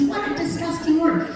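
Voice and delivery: ordinary delivery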